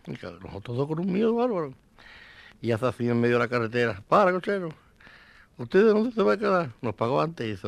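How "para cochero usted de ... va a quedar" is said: calling out. Retold or imitated. Viudo pregunta al cochero.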